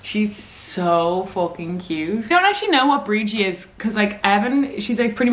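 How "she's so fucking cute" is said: slow, drawn-out gushing; overwhelmed by cuteness. Real, not acted. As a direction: melt over how cute she is. Gushing over her dog.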